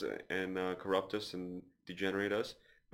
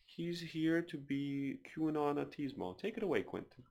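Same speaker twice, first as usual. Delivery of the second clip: slow, higher radio host voice, hammed-up; drawn-out public radio intro, playing it up; mock NPR host; 5 semitones higher, slower than usual. Podcaster introducing his guest Quentin, slow mock radio host. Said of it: "like an N P R type of".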